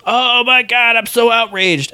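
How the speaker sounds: high, theatrical indignation; mock outrage. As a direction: put on a high-pitched show of being scandalized. Mocking public's instant outrage.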